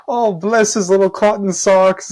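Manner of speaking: high, slow British-style imitation; Rob. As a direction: draw it out in a mock British voice. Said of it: "he said something like".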